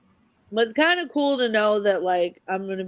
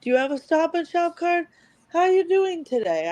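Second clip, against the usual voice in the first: tired bored cashier; 9 semitones higher than usual. Retold or imitated. Imitating cashier checkout lines.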